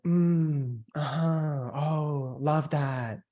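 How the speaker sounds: overeager nodding noises; mock keen salesperson agreeing; exaggerated eager listener. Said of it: "exaggerated example".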